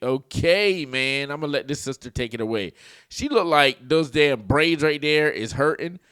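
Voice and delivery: ordinary talk, nothing marked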